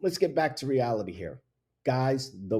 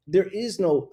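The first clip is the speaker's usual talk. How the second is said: ordinary talk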